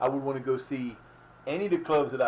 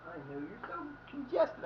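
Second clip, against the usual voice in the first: high, soft cooing to a pet; 10 semitones higher, 6 dB quieter than usual. Real, not acted. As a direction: coo it at the dog in a high voice. Baby talk to his dog.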